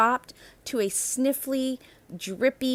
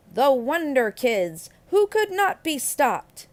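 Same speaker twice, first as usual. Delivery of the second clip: higher and louder, a heroic cheer; triumphant refrain; 5 semitones higher, 5 dB louder than usual. A narrator's reading. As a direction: proclaim it like a superhero title. Triumphant refrain at story's end.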